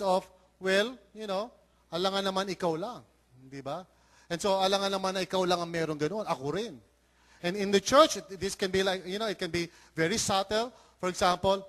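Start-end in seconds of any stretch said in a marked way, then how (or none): none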